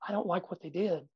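hushed disappointed parent; soft emotional telling. Retold or imitated. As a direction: say it quietly, like it hurts you. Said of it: "emotionally tell them".

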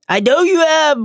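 high, loud furious roar. A narrator's reading. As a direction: roar it right back at her. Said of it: "roared Neville".

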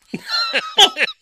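big high burst of laughter; loud laugh. Real, not acted. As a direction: laugh hard at the punchline. Laughing at punchline.

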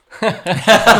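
loud high laugh; laughing at his own punchline. Real, not acted. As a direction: burst out laughing.

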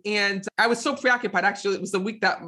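ordinary delivery

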